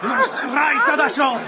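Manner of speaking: high, drawn-out order to yield; triumphant demand. Acted. Winner demands surrender.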